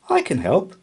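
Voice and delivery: high, loud, eager offer; eager cry. A narrator's reading. Mouse eagerly cries out to help Hedgehog, high and loud. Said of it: "cried Milly".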